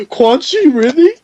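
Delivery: high, slow mocking fan with laughter; giddy hammed-up fan voice; drunk fan. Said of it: "They kept saying".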